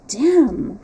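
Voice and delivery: a firm emphatic "Damn!" with a falling end; Percy bites off "Damn!" with emphasis. A narrator's reading. Percy curses emphatically to himself, a firm emphatic "Damn!". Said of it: "repeated those same British lips emphatically".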